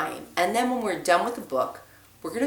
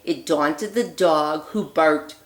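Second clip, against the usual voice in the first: put-on lively read-aloud voice for children; storybook reading; 4 dB louder than usual. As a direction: read it like a bedtime story for little ones. Reads picture book aloud.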